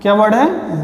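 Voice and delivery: usual speaking voice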